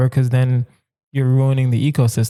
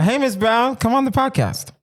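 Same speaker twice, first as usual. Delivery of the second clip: calling out; 9 semitones higher than usual. Real, not acted. Calling out to friend's mom again.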